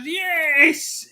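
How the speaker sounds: high, loud, imitated cry of joy; delighted cheer. United fan hearing of Arsenal's win, delighted cheer. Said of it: "they were absolutely delighted".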